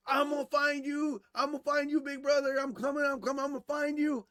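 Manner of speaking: put-on a young boy hunting his big brother, teasing; little brother taunting. Little brother searching the dark basement, playful taunting call. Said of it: "he come barreling".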